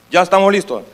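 usual speaking voice

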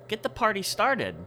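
normal talking voice

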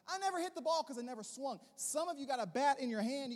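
normal talking voice

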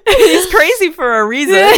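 said through high, loud laughter; laughing. Real, not acted. Laughing at Silco's paranoia with her friend. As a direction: get the words out while cracking up.

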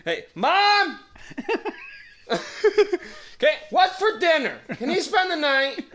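high, loud laughing call to his mom; a boy yelling to his mom for permission; kid calling mom. Pretend. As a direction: shout up the stairs like an excited schoolboy. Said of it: "That's exactly how it went down every time".

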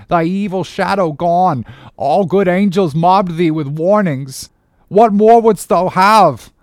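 high, loud impassioned protest; crying out. Acted. Starbuck begging his captain to stop the chase, crying out. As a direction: plead at full voice, building in outrage. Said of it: "cried Starbuck".

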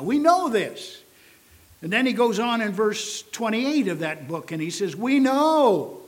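loud, high, ringing "we know"; preaching at full force. Acted. Pastor proclaims scripture to the congregation, full-force preaching. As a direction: proclaim it to the back pew.

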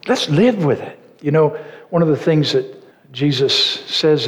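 sermon delivery building to fervent peaks; preaching. Acted. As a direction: preach it out to the whole congregation. Preaching to the congregation.

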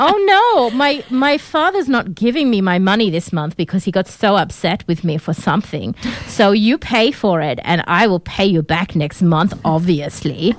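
entitled heiress excuse in a put-on Russian-German accent; Anna. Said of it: "I'm doing an a-hole accent".

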